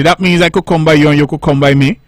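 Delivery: normal talking voice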